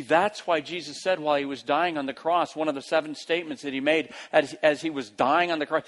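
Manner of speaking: ordinary delivery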